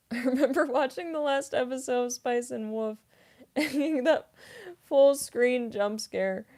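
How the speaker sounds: amused, laugh-filled retelling. Real, not acted. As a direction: tell it through real laughter. Laughs while recalling a jump scare.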